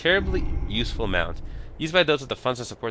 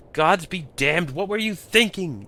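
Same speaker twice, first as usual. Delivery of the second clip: slow, high, louder scolding; scolding yell; 10 semitones higher, 3 dB louder, slower than usual. Acted. Goblin Snell scolds the player after the fall, slow high angry yell. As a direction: drag out the scolding, high and loud.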